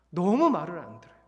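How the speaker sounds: a fed-up sigh of a complaint. Real, not acted. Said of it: "repeated lament, louder take".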